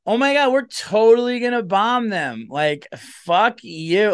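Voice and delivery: put-on high, loud party girl; brash and tipsy, like a drunk girl at a bar; drunk chick in the bar. Female president as a drunk bar girl, high and loud. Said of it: "president going like".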